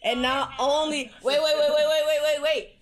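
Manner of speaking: loud, high rapid wait wait wait; teasing excitement. Real, not acted. Worker teasing the caller before revealing the grand prize, loud and high. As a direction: hold them in suspense, bursting with glee.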